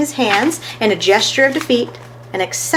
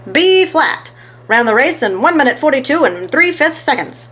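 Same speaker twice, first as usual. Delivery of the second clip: much higher, louder announcement; calling out; 6 semitones higher, 3 dB louder than usual. A narrator's reading. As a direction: bellow the result. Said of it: "called Downtown Fats".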